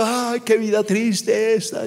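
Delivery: slow, lightly acted lament; half-hearted mock wail; mock weeping. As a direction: imitate a tearful moan, drawn out. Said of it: "Y ellos van llorando".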